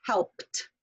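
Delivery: "helped" said slowly ending in a crisp T; T-sound ending. Shown as a demonstration. Teacher to students, modeling the T ending slowly. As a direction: land on a T at the end of "helped". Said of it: "sound like a T".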